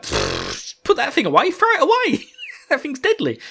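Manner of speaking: lightly voiced Celine, high and fussy; mock Celine. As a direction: squeak it out like a dismissive friend. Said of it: "Celine's just like".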